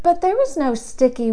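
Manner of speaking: ordinary delivery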